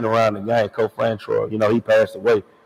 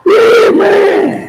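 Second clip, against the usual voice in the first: big high shout of agreement; loud yeah; 10 dB louder than usual. Real, not acted. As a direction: yell it out in joy. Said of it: "loud agreeing shout".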